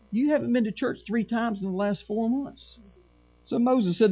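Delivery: ordinary delivery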